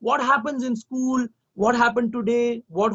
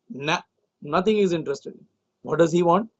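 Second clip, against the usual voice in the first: plain reading voice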